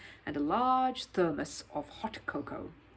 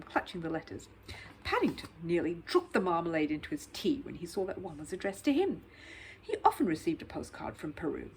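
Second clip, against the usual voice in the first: plain reading voice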